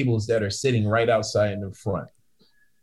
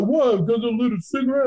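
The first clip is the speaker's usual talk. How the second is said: much higher, outraged question; mock indignant drunk guy; indignant disbelief; 12 semitones higher, faster than usual. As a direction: play the offended drunk.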